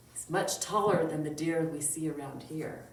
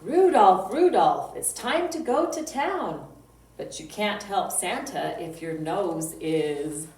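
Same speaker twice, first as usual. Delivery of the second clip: loud, sing-song poem reading; children's poem recital; 6 dB louder than usual. Acted. Librarian reciting a reindeer poem to kids, loud sing-song. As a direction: chant the rhyme out for little listeners.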